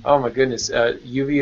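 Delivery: normal talking voice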